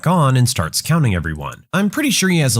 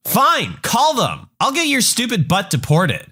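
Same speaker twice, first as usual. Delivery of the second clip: much higher, outraged mom threatening; angry entitled mother; 9 semitones higher than usual. Acted. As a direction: pitch it up into indignant fury. Mom defies the driver.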